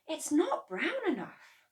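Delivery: put-on high, slow boyish listing. A narrator's reading. George listing what goes in, high and slow. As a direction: tick items off like a boy.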